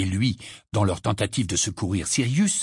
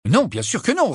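agacé; 11 semitones higher, 4 dB louder than usual. Acted. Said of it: "agacé".

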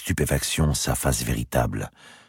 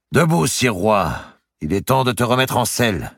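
mocking command; 9 semitones higher, 4 dB louder than usual. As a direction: order the king up with false respect. Narrator voices Maltravers ordering.

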